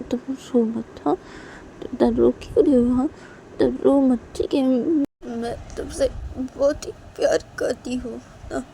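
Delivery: affectionate pleading that softens gradually; consoling Shanaya. Acted. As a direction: hush a crying loved one.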